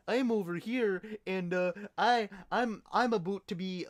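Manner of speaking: hammed-up polite Canadian protest; bad Canadian accent. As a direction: argue politely in a badly faked Canadian accent. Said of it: "do a good Canadian accent".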